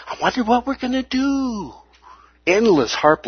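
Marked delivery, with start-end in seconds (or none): 0.1-1.7 high, airy wondering voice; mock wondering. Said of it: "Ever have people talk about it?".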